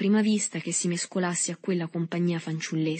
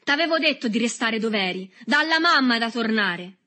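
loud, raised, angry reproach; violent scolding; 5 semitones higher, 6 dB louder than usual. A narrator's reading. Berto scolds Agostino for not staying, angrily loud. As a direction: lay into him angrily. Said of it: "pieno di una violenza incontenibile".

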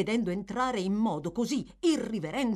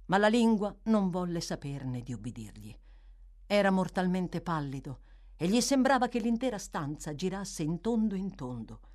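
ordinary delivery, nothing marked

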